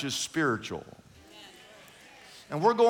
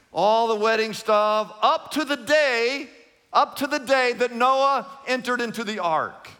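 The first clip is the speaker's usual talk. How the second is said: preaching at full force; 4 semitones higher than usual. Acted. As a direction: drive each line of the verse home. Preaching scripture reading.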